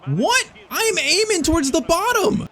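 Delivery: high-pitched incredulous protest; outraged disbelief. Real, not acted. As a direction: protest at the game, voice shooting up. His shot goes wrong.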